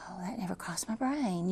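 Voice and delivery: breathy unvoiced whisper, to herself; hushed aside to herself; whispered thought. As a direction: whisper it like a private realization. Retold surprised thought, whispered.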